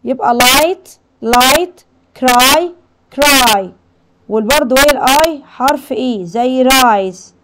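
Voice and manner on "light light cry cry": loud, drawn-out "eye" vowel in لايت and كراي; long I demo. Shown as a demonstration. Teacher drilling long I for pupils, loud, held vowel in لايت and كراي. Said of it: "تدينا آي طويلة".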